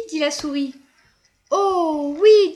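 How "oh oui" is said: excited. Acted. Elena eager for next time.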